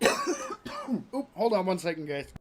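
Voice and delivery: coughing after drinking; talking through a choking cough. Real, not acted. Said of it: "went down the wrong pipe".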